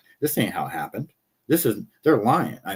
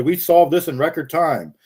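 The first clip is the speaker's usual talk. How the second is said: higher, louder mock public announcement; imitating a boast to the cameras; mock press announcement; 7 semitones higher, 6 dB louder than usual. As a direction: proclaim it mockingly, raised in pitch and volume. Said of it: "stood in front of the cameras and said".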